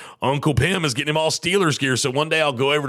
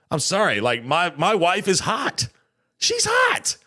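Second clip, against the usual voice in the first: ordinary talk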